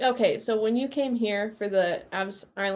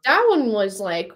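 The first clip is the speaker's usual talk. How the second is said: ordinary delivery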